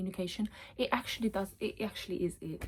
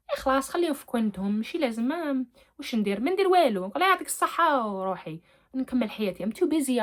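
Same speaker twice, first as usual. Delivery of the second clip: louder, higher, quick-fire dismissal; dismissive brush-off; 5 semitones higher, 5 dB louder, much faster than usual. Acted. Telling a viewer to leave rude people be, dismissive. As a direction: wave them off, raised pitch and volume.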